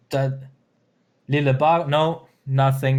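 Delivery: ordinary delivery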